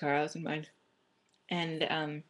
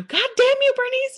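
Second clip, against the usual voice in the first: very high, loud mock scream; movie-quote yell; 12 dB louder than usual. Girl Scouts scene from Dodgeball quoted, high mock scream. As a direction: shriek it as an exaggerated curse. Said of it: "then the, they go".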